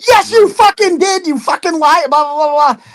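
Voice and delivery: hammed-up furious tirade, pitched high; retold furious yell. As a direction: friend tearing into the liar, high mock shouting. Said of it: "ripped into it".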